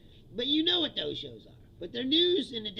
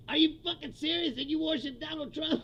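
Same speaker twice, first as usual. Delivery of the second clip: ordinary talk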